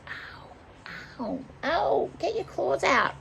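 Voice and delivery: high-pitched ow at being clawed; pained protest. Real, not acted. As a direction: complain to the pet as its claws dig in. Pet's claws hurt her.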